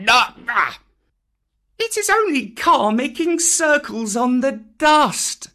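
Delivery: put-on high, piping boy's voice; young boy. Boy reassuring his spellbound friends, high boyish voice. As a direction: open loud, dismissing the danger.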